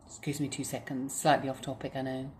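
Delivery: ordinary talk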